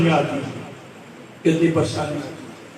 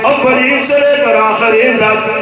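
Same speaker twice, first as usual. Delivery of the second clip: louder, sung-out sermon storytelling; preaching at full force; 2 semitones higher, 6 dB louder than usual. Acted. Preacher telling the congregation a moving story, chanting.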